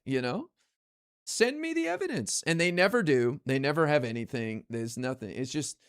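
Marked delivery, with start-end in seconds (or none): 1.3-2.4 pitched well up, breezily asking for proof; mock casual request. Streamer mimicking his stock reply to accusers, high and mock-casual. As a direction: act the unbothered guy asking for receipts. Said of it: "I go".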